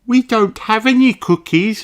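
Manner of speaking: put-on high childlike sheep voice; sweet high young girl voice; little sheep voice.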